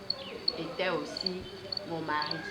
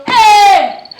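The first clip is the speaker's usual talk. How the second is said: shout; 6 semitones higher, 10 dB louder than usual. Acted. Mistress calls out to dead man.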